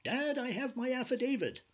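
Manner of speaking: put-on high, excited child voice; imitating a small girl, pitched high; little daughter's voice. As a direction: play a four-year-old bursting in proudly. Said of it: "my little daughter ... saying".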